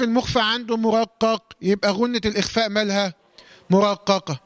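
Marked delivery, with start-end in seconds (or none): none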